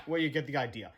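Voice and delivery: plain reading voice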